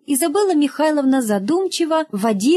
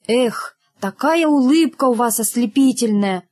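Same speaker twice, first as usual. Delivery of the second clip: ordinary delivery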